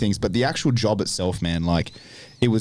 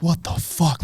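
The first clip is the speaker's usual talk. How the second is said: stunned; 8 semitones higher, slower than usual. Retold or imitated. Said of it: "you're like".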